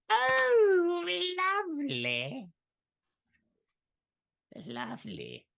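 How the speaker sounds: very high, slow, savouring hag voice. Acted. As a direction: croon it like a delighted old witch. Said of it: "how I've missed [name]'s voicings".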